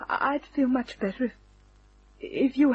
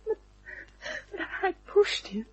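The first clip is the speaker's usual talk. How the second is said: crying; 11 semitones higher, 5 dB quieter than usual. Acted. Girl confesses killing, cries.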